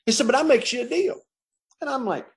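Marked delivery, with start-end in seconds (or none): 0.1-1.2 higher, fast street voice; lightly voiced street cellmate offering a deal; cellmate's voice. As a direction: cellmate bargaining with the new guy.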